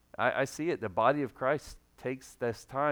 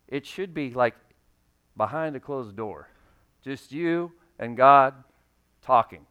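ordinary talk

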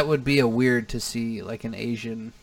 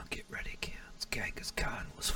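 dramatic hushed whisper; mock documentary whisper to the kids; 18 dB quieter than usual. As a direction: whisper it like a spooky documentary teaser. Mock TV announcement about Genghis Khan.

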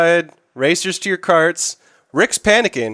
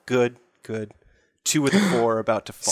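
mumbling; 6 semitones lower, 6 dB quieter than usual. Acted. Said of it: "He mumbles to himself".

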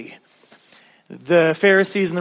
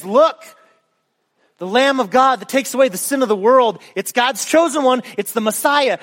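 raised, higher ringing proclamation; proclaiming; 7 semitones higher, 4 dB louder than usual. Acted. John the Baptist points out Jesus to the crowd, ringing proclamation. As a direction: announce it boldly to the crowd.

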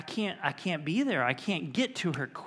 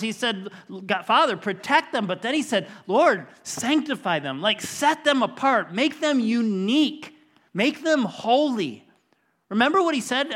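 loud, raised-pitch sermon delivery; emphatic preaching; 4 semitones higher, 7 dB louder than usual. Real, not acted. Preacher telling the congregation what Jesus prayed for them, forceful proclaiming. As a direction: preach it with force, driving each key phrase home.

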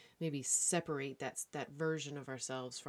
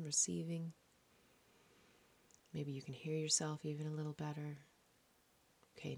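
calm meditative tone; 3 dB quieter than usual. Acted. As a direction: guide them into calm, breath by breath. Leads a breathing meditation.

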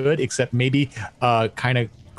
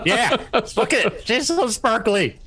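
high, gushing over the ring with a laugh; newly engaged girl; 10 semitones higher than usual. Acted. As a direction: show off the ring like a fresh fiancée. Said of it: "presenting it like you just got proposed to".

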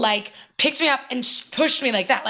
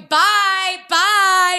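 higher, louder sing-song "bye, bye"; a taunting wave-off; calling out a mocking farewell; mock goodbye; 8 semitones higher, 4 dB louder than usual.

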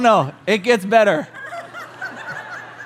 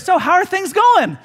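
higher, breezy acted small talk; feigned casual greeting; 6 semitones higher than usual. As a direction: play it carefully casual, pitched up. Acted careful greeting to pregnant woman.

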